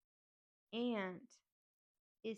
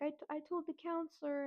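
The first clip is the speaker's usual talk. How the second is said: higher, a mild crying imitation; half-hearted teary confession; retelling a cousin's tearful admission, pitched higher; imitating cousin crying; 7 semitones higher than usual. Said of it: "And she's crying".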